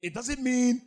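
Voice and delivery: full-force preaching. Acted. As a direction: preach it hard at the congregation.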